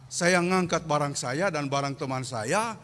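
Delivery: normal talking voice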